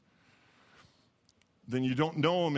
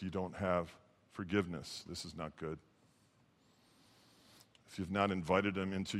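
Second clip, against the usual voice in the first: low, soft, grave preaching; quiet and low, letting pauses hang; solemn sermon appeal; 5 semitones lower, 6 dB quieter than usual. Real, not acted. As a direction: bring it down to a hushed, earnest plea. Grave, hushed appeal within preaching.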